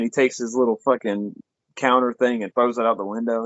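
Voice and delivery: ordinary talk, nothing marked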